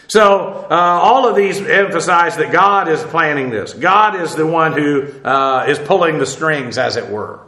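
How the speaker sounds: usual speaking voice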